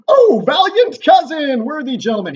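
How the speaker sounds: hammed-up high, loud royal cheer; super stoked Duncan. King hears of his cousin's victory, super stoked shout. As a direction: burst out in overjoyed praise. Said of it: "He's super stoked about this".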